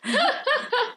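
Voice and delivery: sudden squealing laugh; high burst of laughter. Real, not acted.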